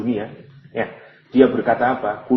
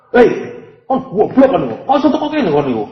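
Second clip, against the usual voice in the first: much higher, louder insulting yell; scornful angry shout; 10 semitones higher, 6 dB louder than usual. Retold or imitated. Angel scolding the sinner in hell, contemptuous shout. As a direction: berate the fool with contempt. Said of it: "Ini pertanyaan marah, pertanyaan menggoblokno".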